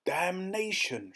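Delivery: high, drawn-out curse; slow, outraged exclamation; exasperated shout. A narrator's reading. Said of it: "shouted the Duke".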